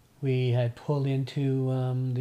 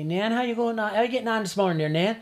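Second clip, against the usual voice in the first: higher, brighter Newfoundland greeting; calling to Nan; 8 semitones higher, 5 dB louder than usual. Retold or imitated. Grandson calling up to Nan on the stairs, cheerful.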